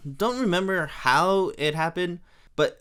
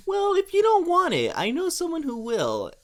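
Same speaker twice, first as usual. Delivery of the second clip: much higher, cartoonish Homer retort; 14 semitones higher than usual. Homer offering the bowling ball to someone else, high and cartoonish. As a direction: squeak it out like a sulking cartoon dad. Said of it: "And Homer says".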